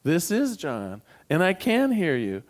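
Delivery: ordinary talk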